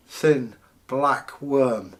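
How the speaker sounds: ordinary delivery, nothing marked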